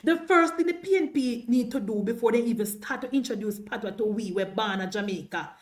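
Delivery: ordinary talk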